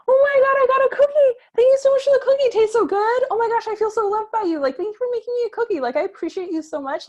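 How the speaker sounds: put-on very high, loud, squealing child's voice; a hammed-up, overjoyed child gushing over a cookie; gushing grateful kid. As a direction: squeal thanks like a thrilled little kid. Voicing a delighted grateful kid.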